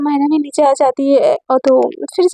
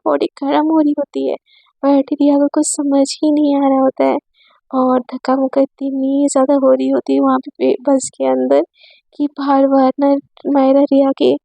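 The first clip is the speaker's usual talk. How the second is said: plain reading voice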